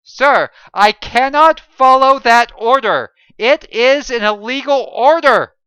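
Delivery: high, loud firm refusal; defiant refusal. A narrator's reading. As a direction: refuse the order to his face. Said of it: "John said again".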